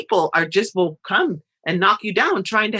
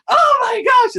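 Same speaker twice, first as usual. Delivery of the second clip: high, loud squeal of excitement; hammed-up gushing oh my gosh; excited girls; 6 dB louder than usual. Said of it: "the girls are like".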